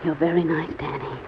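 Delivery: tender hush after his confession; soft and tender. Acted. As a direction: say it gently, close to his ear. Tender reply to a declaration.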